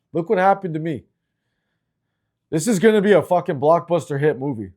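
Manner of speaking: ordinary talk, nothing marked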